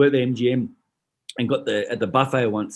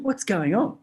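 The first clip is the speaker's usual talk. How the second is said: puzzled workers; 7 semitones higher, slower than usual. Retold or imitated.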